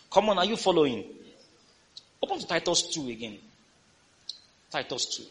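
ordinary talk, nothing marked

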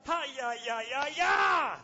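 loud fiery cries; shouted tongues. Real, not acted. Preacher to congregation, loud shouted tongues. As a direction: fire it out at full force.

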